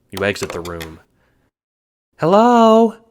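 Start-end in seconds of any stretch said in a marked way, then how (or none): 2.2-3.0 high, much louder held hello; long loud call. A narrator's reading. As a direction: call down the empty hallway. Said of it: "you repeat into the hallway".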